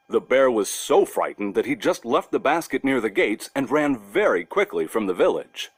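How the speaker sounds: ordinary delivery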